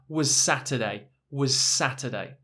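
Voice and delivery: was Saturday with the two s sounds merged into one; linked s sounds. Shown as a demonstration. Teacher shows how was Saturday links together. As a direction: blend was Saturday, one long s, no gap. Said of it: "we mix the words together".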